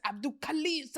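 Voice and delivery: high mimicry of the caller; imitating caller's name delivery. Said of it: "the way he said".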